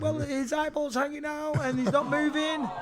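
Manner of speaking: high, weepy mock sob of a frantic wife; retold crying wife. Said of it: "my wife crying her eyes".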